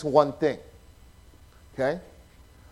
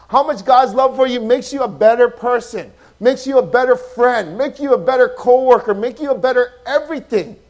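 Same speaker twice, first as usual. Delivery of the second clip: loud, rising sermon build; full-force preaching; 6 semitones higher, 8 dB louder than usual. Acted. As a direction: drive each line harder to the peak.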